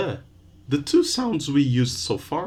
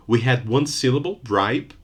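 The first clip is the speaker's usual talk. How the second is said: normal talking voice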